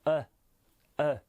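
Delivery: short "E", close to a light A; tiny neutral uh for each "E". Shown as a demonstration. Teacher modelling for learners, very light short "E". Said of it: "mais bien très léger".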